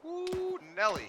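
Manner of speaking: sudden high whoop; excited exclamation. Real, not acted. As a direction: whoop it out, then punch the name. Sudden exclamation during firefight.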